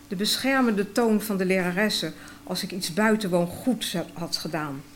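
usual speaking voice

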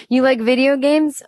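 loud, high mock shout. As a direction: burst in shouting the question. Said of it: "came into the room shouting".

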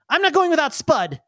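put-on high little-boy protest; insistent kid. Kid refusing to leave his capybara, high insistent voice. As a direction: dig in like a small kid, pitched high. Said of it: "he's like".

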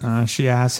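ordinary talk

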